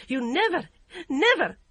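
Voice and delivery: high, loud furious yell; cried out. A narrator's reading. Yum shrieking at her mother-in-law, loud and high. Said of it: "she cried".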